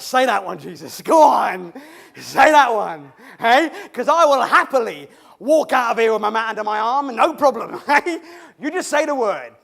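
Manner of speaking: loud, pushy urging with laughs; a desperate man urging Jesus to just say it, loud and laughing; eager shouting. Acted. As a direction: egg Jesus on, nearly yelling. Urging Jesus to heal him.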